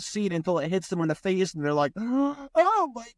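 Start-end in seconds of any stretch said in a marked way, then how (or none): 1.9-3.1 high, slow, hammed-up gasp of disbelief; sarcastic imitation of someone pretending to be stunned; mock shock. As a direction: mimic a fake-shocked person, voice pitched way up. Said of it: "they act like they're shocked".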